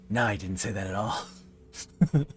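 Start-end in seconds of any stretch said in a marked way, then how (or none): 0.1-1.2 sword voice. Acted. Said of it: "Sword says".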